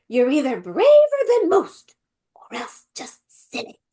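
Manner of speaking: high, loud start dropping to a whisper; hammed-up grandma, hushed on the last words; funny grandma. Imitating Missus Beaver on meeting Aslan, funny grandma. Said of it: "I heard my grandma in that".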